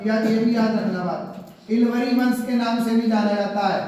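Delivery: usual speaking voice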